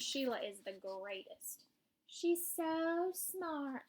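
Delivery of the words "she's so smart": high, very slow, drawn-out praise; gushing mother. A narrator's reading. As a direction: gush slowly as an adoring mother. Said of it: "my mother says".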